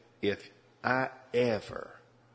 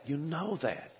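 higher and softer, urgent plea; pleading; 6 semitones higher, 5 dB quieter than usual. Retold or imitated. Preacher urging the adulterous man, higher soft plea. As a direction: repeat it gently, begging him to see. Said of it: "I started to plead with him".

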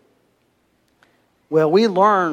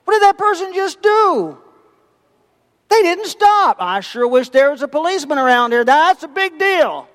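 indignant shock; 10 semitones higher than usual. Retold or imitated. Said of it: "And you say".

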